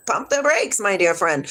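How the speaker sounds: ordinary delivery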